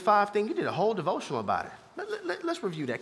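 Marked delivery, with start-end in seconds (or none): 2.0-3.0 calm God voice. Retold or imitated. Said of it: "God was talking to me so calm".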